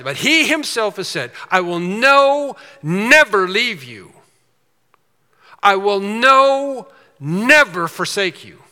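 loud, slow, every word weighed; preacher booming the promise from Hebrews; emphatic proclamation. Acted. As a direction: thunder the promise from the pulpit.

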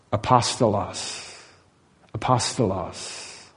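slow, low, soft "Apostolos"; Greek word demonstration. Shown as a demonstration. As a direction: sound out "Apostolos" slowly and quietly, syllable by syllable. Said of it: "it's the word, are you ready?".

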